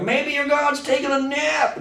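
high, sneering mock shout; jeering prophet calling out; mocking taunt. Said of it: "He's mocking them".

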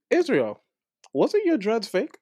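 teasing question. Real, not acted. Teasing friend about dreads.

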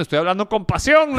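mock passionate defense to his wife; passionate protest. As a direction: prove you're not yelling. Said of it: "le digo".

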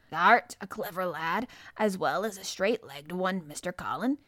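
lightly voiced gruff old gardener, admiring; old man praising the boy, a touch of dialect; old Yorkshire gardener. Old gardener Ben speaking.

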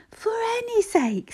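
very high, drawn-out, breathy appeal; urgent pleading. A narrator's reading. Brother urging the fainted boy to rouse, urgent pleading. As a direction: urge him, pitched right up.

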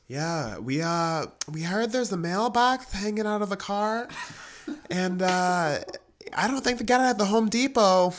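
high-pitched put-on caller voice; imagined dispatch caller. Imagined call to dispatch about a mailbox, mock caller voice. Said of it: "this dispatch call that was like".

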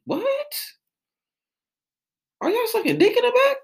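high, incredulous squeal; shocked disbelief. Real, not acted. Comic telling of strip club prices, high shocked squeal. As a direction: shoot up in mock horror.

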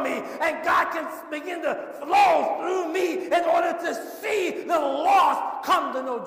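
rising from firm to loud, high shouting; building to a preaching shout. Acted. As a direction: swell into a full pulpit cry.

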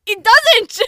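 high, loud contradiction; indignant high-pitched outburst; exasperated shout. Real, not acted. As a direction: yell back in disagreement. Arguing it does not look good.

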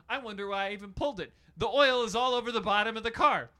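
high, loud, worked-up storytelling; hammed-up rant. Hammed-up car repair rant.

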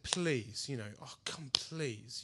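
soft, low retold plea to God; hushed re-enacted begging; pleading prayer. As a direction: beg quietly, voice dropped right down. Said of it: "I cried out to God".

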